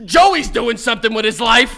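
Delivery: loud dad yell, laughing. Retold or imitated. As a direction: shout it like a disappointed father. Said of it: "things your dad has yelled".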